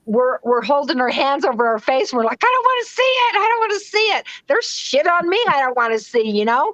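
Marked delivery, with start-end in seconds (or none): 2.4-6.3 high-pitched acted protest, easing down; mock frightened refusal. Healer acting out people covering their faces, mock protesting. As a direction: play someone refusing to look, pitch starting high.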